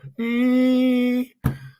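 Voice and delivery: a burst of real high laughter. Real, not acted. Laughing at his own pencil-breaking joke, real laughter. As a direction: crack up at your own joke.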